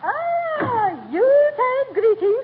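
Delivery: high, slow, gushing grande dame greeting; gushing society lady. Acted. Duchess of Uppington greeting the McGees, gushing. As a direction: sweep in with a trilling, affected hello.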